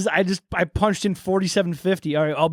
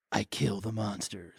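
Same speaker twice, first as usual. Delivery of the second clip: much lower, softer and slow; low quiet deadpan; 12 semitones lower, 14 dB quieter than usual. Acted. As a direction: murmur it cold. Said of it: "So he says".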